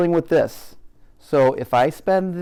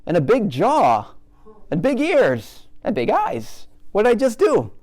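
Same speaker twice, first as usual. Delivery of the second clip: high, louder eager list of big features; excited gushing; 8 semitones higher, 3 dB louder than usual. Retold or imitated. Teacher mimicking an overeager artist, excited and high. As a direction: gush over every feature like a thrilled beginner. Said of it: "and then I'm like".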